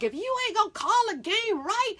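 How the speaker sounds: high, heated coach's complaint at the ref; mad coach yelling. Retold or imitated. Coach yelling at the referee, mock angry. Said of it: "but he was mad".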